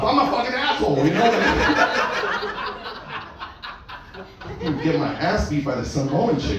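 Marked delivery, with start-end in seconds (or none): 1.3-4.4 laughing. Real, not acted. Laughs at own confession.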